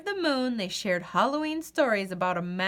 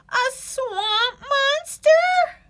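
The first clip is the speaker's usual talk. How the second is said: high, slow frightened gasp of a question; scared question; 6 dB louder, much slower than usual. Acted. Goose hears about the monster, high and scared. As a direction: squeak it out in fear. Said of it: "he asked".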